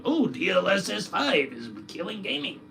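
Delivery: lightly high sneering whine; mock the complainers; mock whiny gamer. Streamer lightly mocking gamers who complain. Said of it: "think about".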